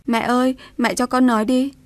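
pleading through tears to be heard; crying out to mother-in-law to let her speak; crying plea. A narrator's reading. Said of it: "khóc lóc nói với bà".

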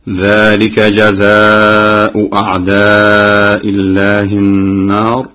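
slow chanted recitation with hamza in أعداء الله; Quran recitation. Shown as a demonstration. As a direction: chant it slowly, hamza kept. Hafs reading before Warsh. (تلاوة بتحقيق الهمزتين)

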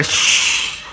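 long, forceful shush. Retold or imitated. Teller shushing the woman, long forceful hush. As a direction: hush her with a drawn-out shh. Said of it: "yo le dije a la mujer".

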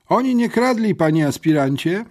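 put-on booming yet cockerel-like boy's voice; loud rooster-like voice. A narrator's reading. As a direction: crow it out like a young cockerel. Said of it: "donośny, acz nieco koguci głos".

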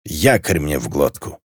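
"Якорь мне в глотку" articulated clearly and low; suddenly distinct. A narrator's reading. Drunk girl swears "Якорь мне в глотку", suddenly distinct. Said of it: "неожиданно отчётливо произнесла Клелия".

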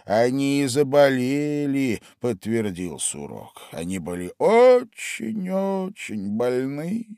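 very slow sleepy Dormouse tale; the Dormouse starting a fairy tale, slow and drowsy; drowsy storyteller. A narrator's reading. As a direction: spin the tale slowly, half asleep. Dormouse confirms.

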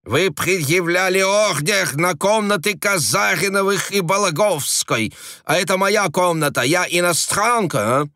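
high, insistent protest; indignant. A narrator's reading. Frenchwoman protesting to the agent, indignant high voice. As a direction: argue haughtily, pitched high.

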